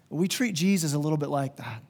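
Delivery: ordinary talk, nothing marked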